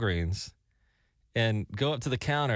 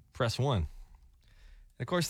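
ordinary talk

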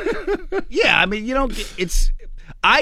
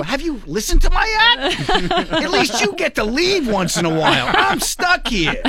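high mock exasperated comeback; joking retort; 13 semitones higher than usual. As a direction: throw it back, exasperated. Retort to wife.

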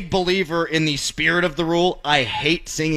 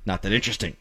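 much softer, lower throwaway; muttered aside; 8 semitones lower, 9 dB quieter than usual. Real, not acted. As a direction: toss it off under your breath. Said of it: "More on that never".

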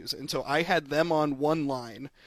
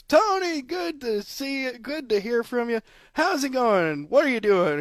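high, bright gushing greeting, much higher; gushing host; 9 semitones higher than usual. Retold or imitated. Retelling the radio host greeting the wrong caller, gushing high. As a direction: gush over the caller like an old friend. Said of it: "And she goes".

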